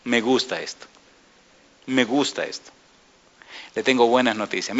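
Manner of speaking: ordinary talk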